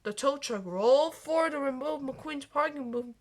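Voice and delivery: plain reading voice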